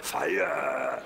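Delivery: grunting, voiceless caveman. Acted. Caveman sending a message from the spirit world, grunted. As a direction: strain the word out like a caveman. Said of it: "A caveman. He's saying".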